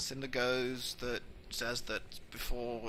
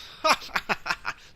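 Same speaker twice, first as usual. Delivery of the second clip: loud "ha-ha-ha" laugh; loud staccato mock guffaw; hearty acted laugh; 6 semitones higher, 9 dB louder than usual. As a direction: laugh out loud as the amused dad. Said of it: "Voltar goes".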